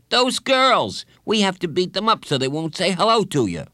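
high, loud schoolboy urging a fight; pushy kid, pitched well up; bossy boy bully. A narrator's reading. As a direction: rattle it out like an indignant kid.

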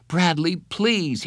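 high, drawn-out plea; pleading. A narrator's reading. Counselor begs boy tearing his report, pleading. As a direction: beg him, stretching the words.

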